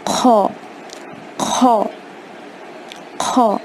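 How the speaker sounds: خو scraped from the upper throat; خو with a guttural kh; rasped kh. Shown as a demonstration. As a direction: give خو a rough kh friction. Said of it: "حلق کے اوپری حصے سے ادا کرنا ہے".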